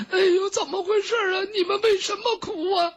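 high sobbing voice asking what happened; sobbing question. Acted. Palace maid at the door asks the others why they cry, high and sobbing. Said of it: "他们在那抽抽搭搭的哭".

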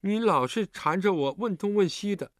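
put-on mildly cross child character; huffy. A narrator's reading. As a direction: complain in a high, peeved voice. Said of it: "韩冰气呼呼地说".

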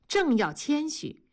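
ordinary delivery

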